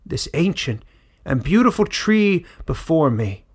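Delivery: put-on old elder denouncing the fugitive, loud and indignant; rally the crowd against the vandal; loud accusing elder. Elder condemning Caleb before the crowd, loud and indignant.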